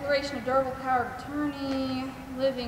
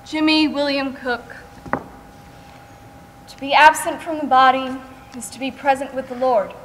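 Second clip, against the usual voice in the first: loud, formal graveside recitation; a solemn scripture reading at a burial; funeral reading; 8 dB louder than usual. Acted. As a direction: declaim the verse over the grave.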